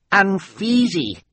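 put-on high, slow baby wail; baby cry. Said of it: "Sunny cried".

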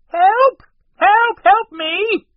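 high, loud cries for help; yelling for help. A narrator's reading. Captive shut inside the cabin, yelling for help. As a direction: scream it desperately. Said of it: "they heard someone yell".

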